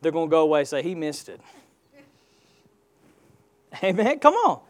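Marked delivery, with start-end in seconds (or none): 0.8-1.4 low, very soft acted aside; lightly acted, hushed dismissal; sceptic's aside. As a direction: drop the voice, playing a doubter walking away. Acting a sceptic's aside afterwards.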